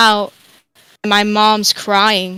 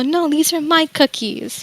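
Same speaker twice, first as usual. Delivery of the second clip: put-on higher, slower, lightly childlike protest; lightly voiced little kid; 7 semitones higher, slower than usual. Little kid telling grandpa off over cookies, lightly childlike. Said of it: "me being a little kid".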